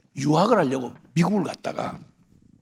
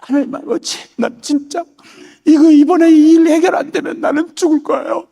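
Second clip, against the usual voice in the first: high, tearful pleading, voice breaking into a cry; crying prayer; 9 semitones higher, 4 dB louder than usual. Retold or imitated. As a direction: beg God through tears, pitched up and louder at the peak. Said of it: "막 울면서". (울먹이며 높은 목소리로 간절히 비는 기도)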